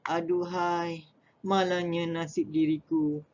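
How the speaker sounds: high, slow mock lament; hammed-up sorrowful aduhai; sad lament. Shown as a demonstration. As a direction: sigh over a cruel fate. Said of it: "menyatakan perasaan sedih".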